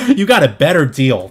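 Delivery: loud, laughing repeat; laughing emphasis. Real, not acted. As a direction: laugh then punch the point. Amused at launch buyers.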